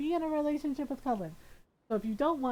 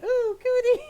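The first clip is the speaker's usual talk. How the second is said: higher, louder mock kid "Ooh, cootie"; childish teasing squeal; 12 semitones higher, 9 dB louder than usual. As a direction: tease like a schoolkid.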